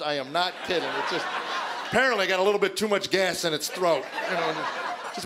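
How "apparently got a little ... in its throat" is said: normal talking voice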